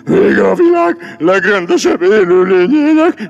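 hammed-up wail building to a high cry; melodramatic lament. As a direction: mourn yourself grandly, rising to a shout. Lion laments himself theatrically.

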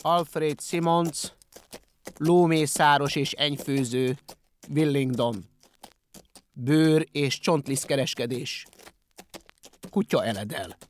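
slow, deliberate read-out; measured, heavy proclamation; reading a sign aloud. A narrator's reading. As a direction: spell out the grim notice piece by piece. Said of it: "halálos csendben felolvasta".

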